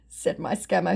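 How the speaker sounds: usual speaking voice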